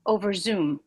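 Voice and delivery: ordinary delivery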